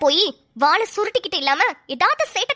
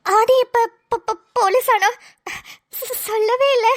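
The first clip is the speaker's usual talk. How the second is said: high-pitched, stunned stuttering; shocked stammer; 4 semitones higher, slower than usual. Acted. Wife learns Aadhi became police, shocked stammer. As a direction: stumble on the words in disbelief.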